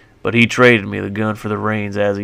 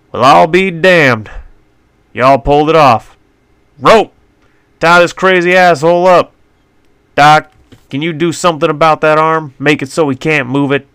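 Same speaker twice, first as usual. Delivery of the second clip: rough sheriff; 6 semitones higher, 7 dB louder than usual. A narrator's reading. Sheriff voiced, loud drawling.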